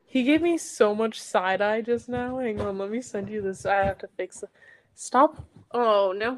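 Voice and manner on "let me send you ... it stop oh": ordinary talk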